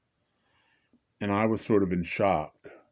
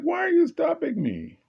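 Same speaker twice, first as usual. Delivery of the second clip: high indignant protest; 4 dB louder than usual. Retold or imitated. Indignant quote.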